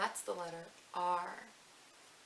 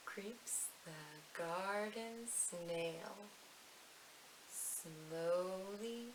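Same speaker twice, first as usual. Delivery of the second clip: drawn out, soft and very slow like a creeping snail; 7 dB quieter, much slower than usual. Shown as a demonstration. Teacher reciting to little ones, slow and soft. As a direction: stretch each word out slowly and softly. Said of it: "say the slowly snail part slowly".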